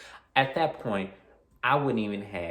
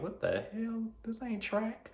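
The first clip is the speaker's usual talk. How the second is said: shocked; 14 semitones higher, 8 dB quieter than usual. Retold or imitated. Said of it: "we were like".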